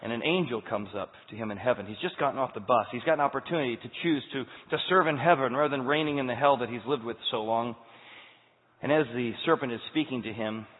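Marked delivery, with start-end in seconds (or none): none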